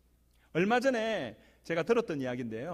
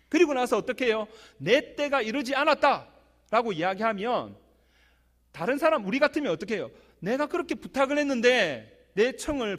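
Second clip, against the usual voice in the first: louder, higher exhortation from the pulpit; full force preaching; 6 semitones higher, 7 dB louder than usual. Acted. Pastor urging the congregation not to stumble, fervent. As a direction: press the point on the congregation.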